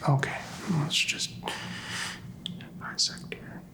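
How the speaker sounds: ordinary talk, nothing marked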